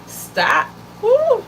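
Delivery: high startled "stop" and a sharp whoop; caught-out exclamation. Real, not acted. Woman feeling convicted by her own teaching, high startled whoop. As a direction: yelp as if the point just hit home.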